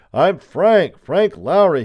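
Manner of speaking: loud, high desperate shout; crying out. A narrator's reading. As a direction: shout your name to be heard outside. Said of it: "he cried".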